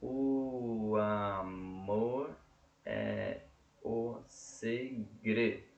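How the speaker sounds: muito lento, sílaba por sílaba; escansão silábica. Shown as a demonstration. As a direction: separe cada sílaba devagar. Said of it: "Um, dois". (escandindo o verso devagar)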